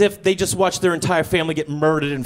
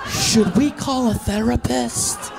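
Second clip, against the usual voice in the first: slow, higher voice of a concerned crowd; slightly higher, slow mock concern; mimicking a worried audience; 5 semitones higher, slower than usual. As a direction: draw it out like a worried onlooker. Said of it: "You guys are just like".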